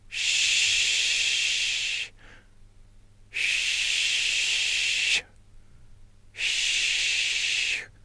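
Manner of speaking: soft unvoiced "Chut" hissed out long; shh hiss. Shown as a demonstration. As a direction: push out a quiet shushing breath.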